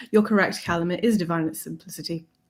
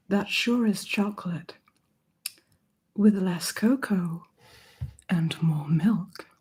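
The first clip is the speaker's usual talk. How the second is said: slow breathy ASMR whisper; 2 semitones lower, 5 dB quieter, slower than usual. Acted. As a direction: whisper it slowly into the mic. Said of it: "A S M R? I'll try".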